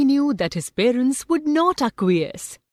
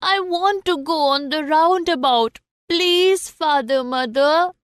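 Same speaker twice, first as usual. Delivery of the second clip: put-on high, pleading little boy's voice; a small child asking his parents for a ride; child begging; 6 semitones higher than usual. Boy pleading with his parents for the roundabout, high and eager. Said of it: "He said".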